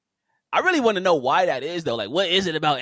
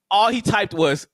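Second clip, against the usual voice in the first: ordinary talk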